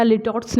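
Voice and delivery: "litotes" said as "LIT-ots"; short i in "litotes", clipped to two syllables; mispronounced litotes. Shown as a demonstration. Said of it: "या litotes नहीं पढ़ेंगे".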